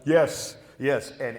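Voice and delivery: ordinary delivery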